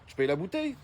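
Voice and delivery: normal talking voice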